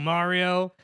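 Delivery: ordinary talk, nothing marked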